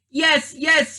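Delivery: high, loud hurried yes yes; frantic eager agreement; panicked cry. Acted. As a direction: blurt it in a panic to undo the spell. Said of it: "he cried".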